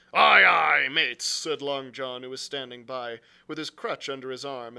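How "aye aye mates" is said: very loud answering call; hearty sailor's reply. A narrator's reading. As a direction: boom it back to your shipmates. Long John answers the shouting crew.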